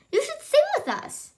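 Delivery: very high chirpy bird voice. Acted. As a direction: sing the line like a little bird. Birds invite Jim.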